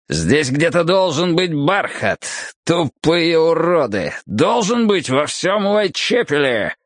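put-on drunken man's frustrated snarl; angry drunk. A narrator's reading. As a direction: drag out each furious word. Drunk man rages about missing velvet.

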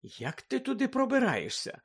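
soft, high, all innocence; innocent tone. A narrator's reading. Hermione asks Fred the way to the kitchen, innocent tone. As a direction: ask it lightly and sweetly, feigning innocence. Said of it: "абсолютно безневинним тоном".

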